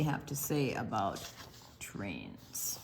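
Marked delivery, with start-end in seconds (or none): none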